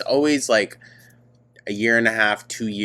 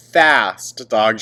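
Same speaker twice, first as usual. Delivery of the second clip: usual speaking voice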